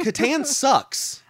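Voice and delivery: drawn-out, high and loud; exaggerated loud declaration. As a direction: stretch it out like a provocation. Deliberate provocation, drawn out.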